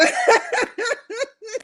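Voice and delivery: high-pitched wheezy laughing fit. Real, not acted. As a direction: burst into a squealing laugh. Laughing at her own joke.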